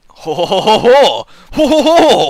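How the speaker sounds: loud French-accented ho-ho-ho; mock French laugh. Shown as a demonstration. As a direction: warm up a French accent with guffaws. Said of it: "That's how you get into a French accent".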